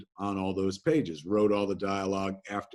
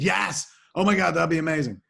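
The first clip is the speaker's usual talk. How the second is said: high, fast, loud burst of delight; thrilled, rushing yes; excited yes; 7 semitones higher, 5 dB louder than usual. Retold or imitated. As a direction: gush with sudden joy. Retold eager acceptance.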